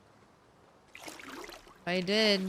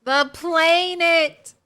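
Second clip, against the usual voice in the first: dramatic shout; 8 semitones higher, 12 dB louder, slower than usual. Pretend. Mock echo of villain line.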